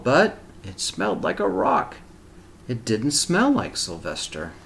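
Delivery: put-on reading a picture book aloud to children; steady, expressive storybook reading; story narration. A narrator's reading.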